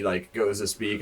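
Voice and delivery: ordinary delivery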